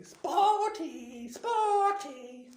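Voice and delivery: very high mocking yell. Peeves chasing Myrtle out, mocking yell. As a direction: chase her with the taunt.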